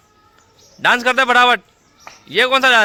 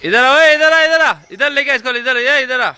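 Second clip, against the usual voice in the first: raised, high summoning call; loud high call that eases in volume; 6 semitones higher, 3 dB louder than usual. Real, not acted. Calling children over to him.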